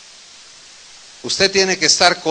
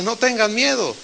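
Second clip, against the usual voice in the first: higher-pitched call across the water; 6 semitones higher than usual. Retold or imitated. Jesus calling to frightened disciples, raised reassuring call. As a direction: lift the voice to reach them over the wind. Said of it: "Jesús les grita y les dice".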